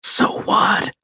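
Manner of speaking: high, breath-only whisper. A narrator's reading. Wounded soldier answers the medic's objection, whispering. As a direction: whisper it back as a challenge. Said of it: "Joe whispered".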